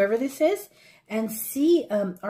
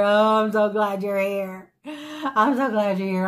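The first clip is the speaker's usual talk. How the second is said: slow, drawn-out mock outpouring of relief; heartfelt gushing; 5 dB louder than usual. What she wanted to tell her favorite volunteer, gushed slowly. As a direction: gush it out, stretching every word.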